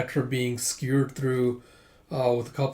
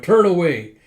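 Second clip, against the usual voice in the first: loud, slightly high, flustered order; embarrassed shout; 4 semitones higher, 9 dB louder than usual. Acted. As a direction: order onlookers to look away, loudly. It's, uh, Yona going.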